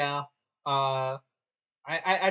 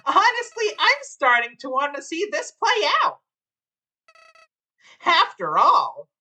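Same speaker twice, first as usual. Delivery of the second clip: higher smug villain voice; smug flower voice; 14 semitones higher, 9 dB louder than usual. Acted. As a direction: play a sly gleeful flower.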